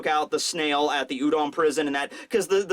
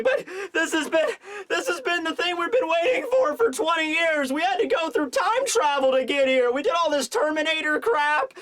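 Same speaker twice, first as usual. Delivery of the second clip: high, whiny tearful pleading; hammed-up breakdown, pitched high throughout; mock weeping; 8 semitones higher than usual. Kinemon breaking down as nobody shows up, mock crying. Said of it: "He starts to cry, starts to weep".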